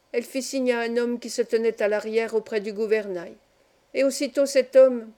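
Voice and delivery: ordinary delivery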